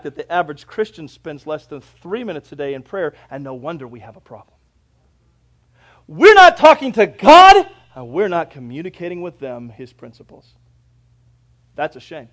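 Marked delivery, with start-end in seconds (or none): 6.1-7.7 sudden loud, high, drawn-out cry; loud pulpit exclamation; preacher's outburst. Real, not acted. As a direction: thunder it slowly from the pulpit.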